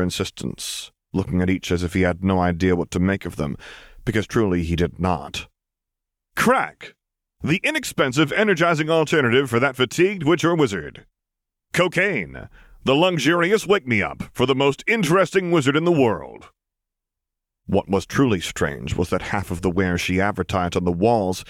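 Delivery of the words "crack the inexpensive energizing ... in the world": much higher, bright salesy ad read; mock advertisement voice. A narrator's reading. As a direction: sell it like a cheesy commercial. Poster ad copy read.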